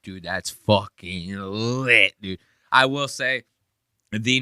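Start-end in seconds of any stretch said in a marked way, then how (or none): 0.0-2.4 imitating Nick. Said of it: "Nick's like".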